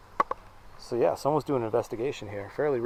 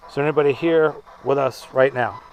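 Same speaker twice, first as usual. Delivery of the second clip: calling out; 5 dB louder than usual. Real, not acted. Calling out to spirits in crypt.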